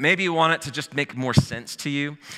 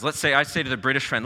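normal talking voice

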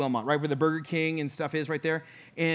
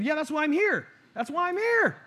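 eager reassurance; 10 semitones higher than usual. Retold or imitated. Reassuring the crying lady.

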